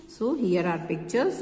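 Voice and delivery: usual speaking voice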